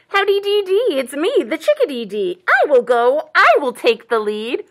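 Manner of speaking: loud, chirpy, sing-song little bird; volunteer boldly as a chickadee; brave chickadee. A narrator's reading. Chickadee offers to take the lead, brave and loud. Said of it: "chickadee is being brave".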